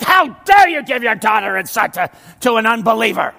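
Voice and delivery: hammed-up indignant rebuke; upset Nehemiah. Pastor acting an upset Nehemiah scolding a father, mock outraged shout. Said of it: "Nehemiah is so upset".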